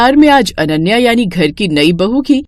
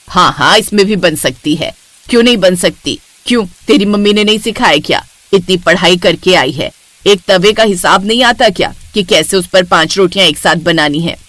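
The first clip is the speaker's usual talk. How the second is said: low, bossy mother-in-law voice; a domineering saas giving orders; lower-pitched strict older woman; stern mother-in-law; 4 semitones lower than usual. Acted.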